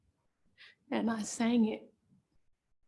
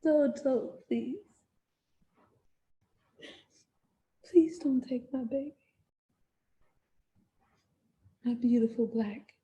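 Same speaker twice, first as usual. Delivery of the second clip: broken, higher-pitched begging; desperate pleading; 4 semitones higher than usual. Acted. Mother begging them not to take her baby, desperate pleading. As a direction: beg haltingly, voice lifting high.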